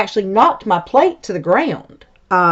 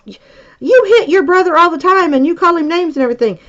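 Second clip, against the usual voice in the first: put-on higher, exasperated reminder to her kid; a mother calling out her son's double standard, scolding; scolding mom; 9 semitones higher than usual. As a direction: point out the hypocrisy, high and pointed. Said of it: "And I'm like".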